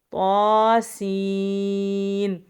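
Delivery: طاسین very slow, long stretched سین with whistle; drawn recitation. Shown as a demonstration. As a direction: chant طاسین in tajweed. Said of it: "طاء کو بھی ایک الف کے برابر کھینچیں گے".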